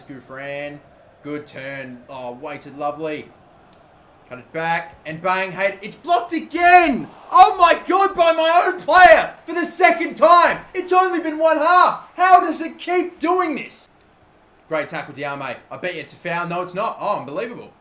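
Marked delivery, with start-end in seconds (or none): 5.8-13.9 real disbelieving yell, high and loud, slow; enraged gamer howling at own players; furious shout. Real, not acted. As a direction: scream it as the shot gets blocked again. Shot blocked by own player.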